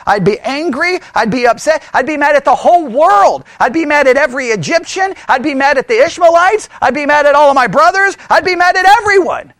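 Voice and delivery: worked-up ranting, each phrase punched; furious, angry. Acted. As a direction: build an angry list toward a peak. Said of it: "I would be furious".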